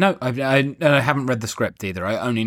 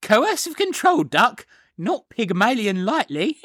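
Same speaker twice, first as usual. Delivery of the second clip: high-pitched Cockney flower seller; Eliza Doolittle accent; 10 semitones higher than usual. Acted. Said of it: "I can't do an Eliza Doolittle accent".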